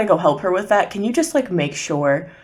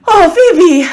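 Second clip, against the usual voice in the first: high, loud happy welcome; excited greeting; 12 semitones higher, 11 dB louder than usual. Acted. Mother welcoming Phoebe at the door, high and loud. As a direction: greet her with sudden relief. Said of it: "she goes".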